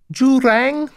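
put-on high-pitched voice with a thick Cuban accent. A narrator's reading. Towering butler answering the door, high thick Cuban accent. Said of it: "a high voice with a thick Cuban accent".

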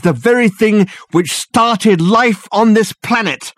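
high, loud, urgent realization; urgent revelation. A narrator's reading. Dirk revealing the ghost's purpose to Reg and Richard, urgently. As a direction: hammer home the horror as it dawns.

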